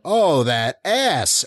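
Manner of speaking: slow imitation of a shout-out; retold shout. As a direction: imitate a heckler, stretching each word slowly. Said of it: "one guy just shouted out".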